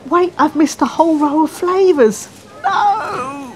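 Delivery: high, loud comic panic; panicked cry. Acted. Customer spots a missed row of flavours, panicked cry. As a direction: wail it in mock despair, pitch shooting up.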